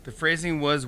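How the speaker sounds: usual speaking voice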